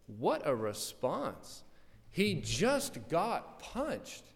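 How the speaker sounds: ordinary delivery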